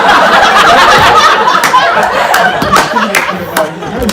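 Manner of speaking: high, loud laughter breaking through; laughing. Real, not acted. Comic laughing at his own airport story. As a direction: laugh at your own punchline.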